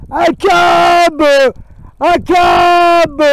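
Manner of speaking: loud, high chant of A cab; imitating Spanish shouts. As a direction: bellow it like a street protester. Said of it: "ils gueulent aussi, euh, ici, les Espagnols". (cri de manifestant espagnol imité, très fort et aigu)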